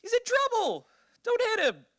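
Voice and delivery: put-on high, excited child calling to mom; kid's voice. Grandson in the back seat begging his mom, high kid voice. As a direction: squeak it up like a little kid. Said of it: "Would have been like".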